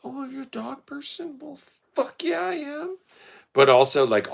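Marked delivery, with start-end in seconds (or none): 0.0-1.4 very high, softer put-on question; soft, high owner voice; dog owner voice. 1.4-3.0 very high, squeaky put-on answer; high mock-cute boast; acted proud reply.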